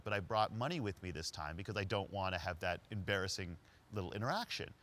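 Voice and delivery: ordinary delivery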